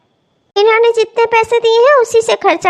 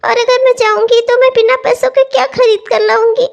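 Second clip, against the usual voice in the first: ordinary delivery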